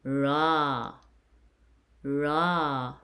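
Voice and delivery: "ra" with a drawn-out open ah; "ra" slowly, one stretched syllable; long A syllable. Shown as a demonstration. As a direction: hold "ra" long for pupils to copy. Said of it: "it's called".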